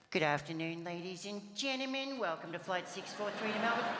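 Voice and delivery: lightly mock cabin crew voice, dropping the T in gentlemen; sing-song airline PA read; flight attendant announcement. Cabin crew greeting passengers on the flight, mock announcement voice. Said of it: "remove the letter T from the word gentleman".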